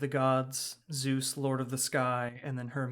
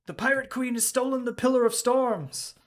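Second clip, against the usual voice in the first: high, loud alarmed cry; panicked townsperson; 10 semitones higher, 6 dB louder than usual. Acted. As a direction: shout the news in alarm. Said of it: "another says".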